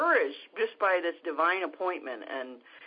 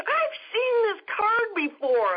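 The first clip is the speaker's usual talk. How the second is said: high, loud burst of amazement; retold excited cry, pitch way up; excited recognition; 7 dB louder than usual. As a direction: exclaim it as the amazed stranger. Stranger recognizes her testimony card.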